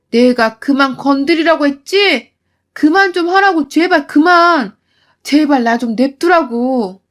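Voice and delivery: 크게 소리치다 느리게 끊어 말하기; 폭발한 외침. Retold or imitated. 음식을 뒤집어 버렸습니다. (시어머니에게 폭발하는 며느리)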